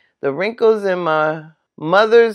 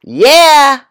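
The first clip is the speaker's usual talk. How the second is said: loud yeah; 6 semitones higher, 9 dB louder than usual. Retold or imitated. Child answers rudely.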